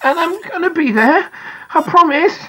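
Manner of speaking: put-on earnest boy making a promise. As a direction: promise your hero with all your heart. Boy silently answers his hero.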